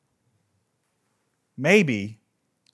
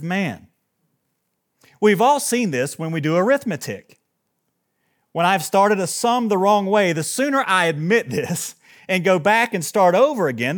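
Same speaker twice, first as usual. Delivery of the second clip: higher, louder declamatory reading; emphatic quote reading; 5 semitones higher, 7 dB louder than usual. Acted. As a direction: read the passage out with raised, punched emphasis. Reads a book passage aloud.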